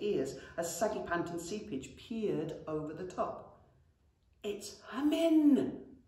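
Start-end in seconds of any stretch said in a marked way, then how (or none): 4.4-6.0 slow, high hushed goblin; hissing goblin. A narrator's reading. Goblin whispers to brother about dragon, hushed. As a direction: hiss it in awe. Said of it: "hissed Seepage".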